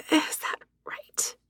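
slow, stunned whisper. Acted. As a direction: breathe the question out slowly. Disbelieving hushed question.

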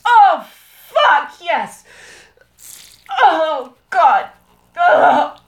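very loud, high cries; full-voiced, climactic yell; ecstatic shouting. Real, not acted. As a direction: cry out uncontrollably. Intimate climax.

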